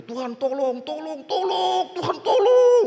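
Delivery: mock cries for help. Flood victim on the roof begs God, hammed-up rising cries. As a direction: pitch climbing to a high, drawn-out wail. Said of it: "Wah, sampai teriak-teriak dia".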